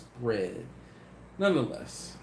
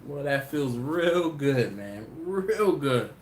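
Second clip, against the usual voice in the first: savoring; much slower than usual. Retold or imitated. Host savoring the praise.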